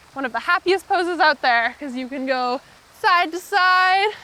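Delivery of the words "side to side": higher, slow sing-song side to side; slow playful. Acted. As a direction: stretch the words as you rock. Playful happy baby rocking.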